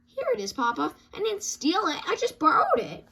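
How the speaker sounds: 1 cub excuse. Acted. Cub caught with the paper.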